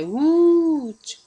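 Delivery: "út-" held high and loud; stressed first syllable. Shown as a demonstration. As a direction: belt out a long "ú" in "út-". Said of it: "a sílaba mais forte é a antepenúltima".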